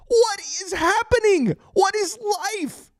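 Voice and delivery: can't process what just happened; stunned disbelief. Real, not acted. Stunned by comeback win.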